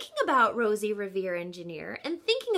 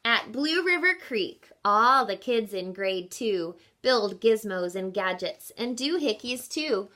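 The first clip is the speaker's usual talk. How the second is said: story narration. A narrator's reading. Teacher finishing the picture book for children, calm narration. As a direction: read it gently toward bedtime.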